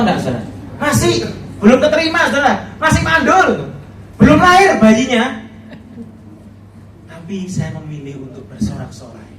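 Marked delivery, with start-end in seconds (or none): 0.8-5.2 much higher, louder preaching cry; fervent emphasis. Real, not acted. Preacher stresses unanswered prayer to congregation, high fervent. 7.1-9.3 much softer, tender resolve; soft sincere. Real, not acted. Preacher confides his choice to praise, very soft.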